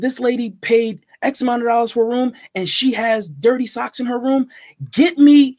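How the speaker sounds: retold boss rising in outrage; indignant manager. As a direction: scold staff in front of the guest. Manager dressing down housekeeping director.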